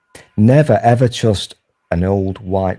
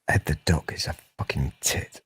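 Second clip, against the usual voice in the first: whispering; 6 semitones lower, 9 dB quieter than usual. Real, not acted. Aside, whispered insult.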